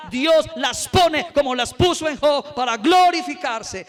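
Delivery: full-force preaching. Acted. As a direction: preach it hard to the congregation.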